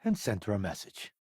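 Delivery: normal talking voice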